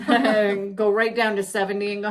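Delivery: usual speaking voice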